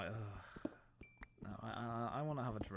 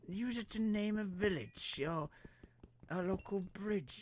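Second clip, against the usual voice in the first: higher, louder, slow indignant cry; mock outrage; 10 semitones higher, 4 dB louder than usual. Reader objecting to Welsh village names, mock indignant. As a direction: protest it like an offended gentleman.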